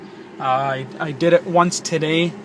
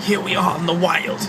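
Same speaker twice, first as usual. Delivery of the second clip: nature documentary; 3 semitones higher than usual. Pretend. Mock nature documentary about a Prius.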